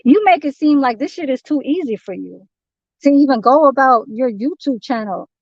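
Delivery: ordinary talk, nothing marked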